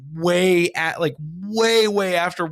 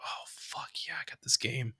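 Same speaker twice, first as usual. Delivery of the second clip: imitated quiet thrill, near whisper; much softer, whispery private glee; hushed excitement; 13 dB quieter than usual. Kid self thrilled by a rental, hushed excitement.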